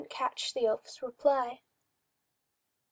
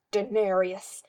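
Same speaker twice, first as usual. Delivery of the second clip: snarled; 3 semitones lower, 4 dB louder, slower than usual. Acted. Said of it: "When Fenris snarled".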